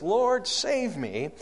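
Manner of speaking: high, drawn-out plea for rescue; crying out. Acted. Sinking Peter cries out to Jesus, acted plea for help. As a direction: cry it slowly, up high. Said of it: "Peter cries out".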